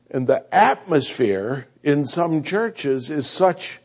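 usual speaking voice

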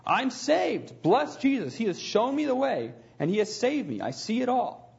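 high, excited outburst of sudden joy; joyful cry. A narrator's reading. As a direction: read it as a startled, delighted cry. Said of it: "She startled us all as she cried".

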